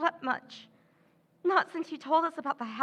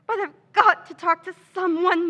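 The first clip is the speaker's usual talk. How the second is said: near tears, voice rising and louder; tearful plea; 2 semitones higher, 7 dB louder than usual. Acted. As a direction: put on a tearful confession to spread gossip. Feigned distress to shopkeeper.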